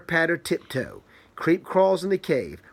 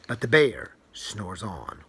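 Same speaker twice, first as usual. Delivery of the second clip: sleepy refrain; 8 semitones lower, 4 dB quieter than usual. A narrator's reading. Reading to a child, the bear's refrain low and hushed. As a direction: lull it down like a bedtime refrain.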